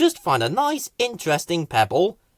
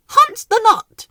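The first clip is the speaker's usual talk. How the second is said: much higher, louder; high, louder gleeful pitch; excited suggestion; 8 dB louder than usual. Acted. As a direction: announce it with glee.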